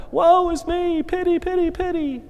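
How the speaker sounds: hammed-up self-pitying lament; mock self-pity. Preacher mocking Elijah's despair to the congregation, high whiny wail. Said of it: "You know".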